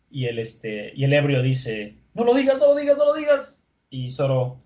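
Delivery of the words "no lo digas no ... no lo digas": high, frantic repeated plea; hammed-up squeal of dread; panicked pleading. Drunk vendor dreads the secret revealed.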